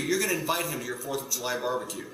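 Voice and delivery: ordinary talk, nothing marked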